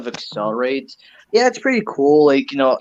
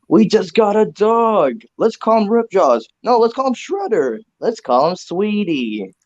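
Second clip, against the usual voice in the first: higher, slower, light family banter voice; quoting a movie family; 6 semitones higher, slower than usual. Retold or imitated. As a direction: pitch it up like a sitcom parent. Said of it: "I'm about to do a reference".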